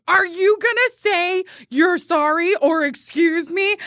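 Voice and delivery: high, slow, pointed challenge; acted confrontation. Friend confronting girl waiting for gum, acted challenge.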